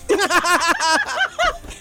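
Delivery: high-pitched giggle. Real, not acted. Host laughing at the joke she just told, high giggle. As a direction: giggle at your own joke.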